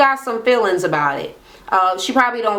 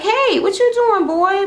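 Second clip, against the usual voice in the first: higher, sugary mock-friendly hello; fake sweet greeting; 12 semitones higher than usual. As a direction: put on a sweet phone voice to lure him in. Sweet-talking him on the phone.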